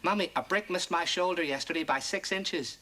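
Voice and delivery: usual speaking voice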